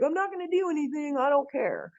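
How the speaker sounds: lazy mock shrug in the voice; mock apathetic voice. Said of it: "If we're just gonna be like".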